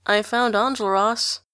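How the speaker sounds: usual speaking voice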